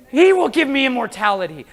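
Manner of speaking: ordinary delivery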